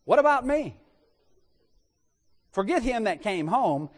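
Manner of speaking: high, loud "what about me" cry; a wounded, self-pitying outburst; indignant cry. Acted. Elder son demanding attention from his father.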